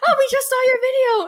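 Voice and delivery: excited fan. Retold or imitated. Baristas recognize her and gush, high and excited.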